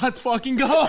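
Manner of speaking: loud, high, laughing celebration; elated mock victory yell; retold jubilant cheer. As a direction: burst out cheering through laughter. Laughs acting out his jubilant reaction.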